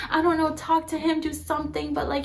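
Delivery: high-pitched retold pleading; freaking out. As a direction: imitate a neighbor begging for help. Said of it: "just freaking out and just like".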